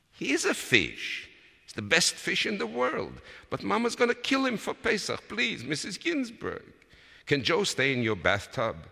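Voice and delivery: put-on high, urgent child's plea; pleading child. A narrator's reading. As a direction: beg hard for a pet's life.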